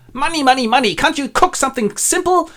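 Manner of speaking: high-pitched fussy complaint; exasperated miser. Acted. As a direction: fret loudly over the expense. Miser objects to spending.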